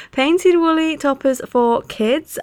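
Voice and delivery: slow, high title announcement; announcer read. Real, not acted. Podcaster naming the reviewed pattern, slow announcing. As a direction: present the title with a flourish. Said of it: "announcing the pattern name".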